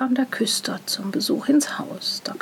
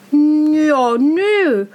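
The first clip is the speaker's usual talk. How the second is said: drawn-out offhand no; 4 semitones higher, 7 dB louder than usual. A narrator's reading.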